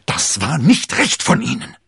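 loud, high, cutting reproach; seething royal rebuke; hissing anger. A narrator's reading. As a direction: spit out the reproach furiously. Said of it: "zischte der König".

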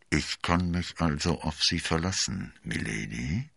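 lightly muffled, as if through a stovepipe; muffled cardinal. A narrator's reading. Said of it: "tönte es dumpf".

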